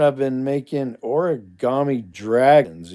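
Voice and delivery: ordinary delivery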